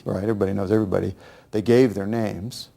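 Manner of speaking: ordinary talk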